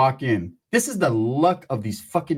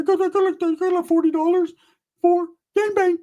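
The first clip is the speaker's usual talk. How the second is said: much higher squeaky stammer; squeaky Ricky voice. Retold or imitated. Ricky stammering how much money he has, squeaky. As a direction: stammer it high and thin.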